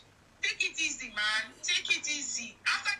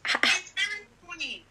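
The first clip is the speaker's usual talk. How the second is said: sarcastic whisper; 4 semitones higher, 6 dB louder, slower than usual. Real, not acted. Sarcastic remark closing a rant.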